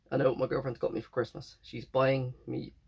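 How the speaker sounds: usual speaking voice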